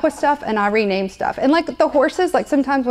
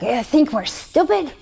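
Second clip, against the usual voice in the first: attempted Australian accent, slow and higher; 4 semitones higher than usual. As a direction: have a go at a hammy Australian accent. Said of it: "That's my Australian accent".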